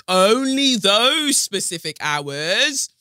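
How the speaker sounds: high, loud, stretched-out "only those"; incredulous emphasis. Real, not acted. Podcaster questioning police's lost recordings, incredulous stress on "only those". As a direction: draw out "only those" loudly in disbelief.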